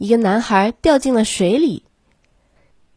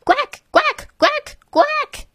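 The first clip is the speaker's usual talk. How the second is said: high squeaky duck quacks; duck quacking; slower than usual. A narrator's reading. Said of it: "cried Ping".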